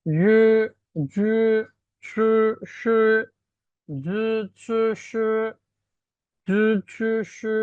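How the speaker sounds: জু, শু drawn out with narrowed mouth; mouth slightly closed. Shown as a demonstration. Teacher showing the first set of sounds, mouth narrowed. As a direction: push জু, শু forward, mouth nearly shut. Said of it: "মুখটা একটু বন্ধ রেখে".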